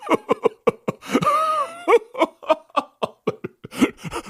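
rire aigu et éclatant; gaieté formidable; grand éclat de rire. Acted. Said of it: "éclats de rire". (Patrice éclate de rire, aigu)

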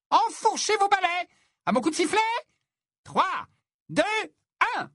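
very high, commanding referee voice; high-pitched orders, then a deliberate countdown; referee calling out. A narrator's reading. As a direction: call out the start across a pitch. Referee starts the match.

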